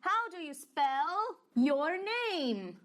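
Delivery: very slow "how do you spell your name?"; teacher modelling the question very slowly; slow model question. Shown as a demonstration. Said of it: "Então".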